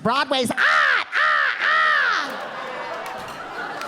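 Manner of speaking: put-on Boston accent building to a seagull yell; squawked Boston accent. Boston date insists on Broadway, yelling like a seagull. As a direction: turn the word into a high Boston gull squawk. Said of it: "Stop yelling at me".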